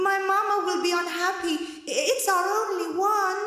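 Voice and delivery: put-on high, quick, pleading child; a small girl begging politely; timid little girl voice. A narrator's reading. Rosetta calls down, pleading.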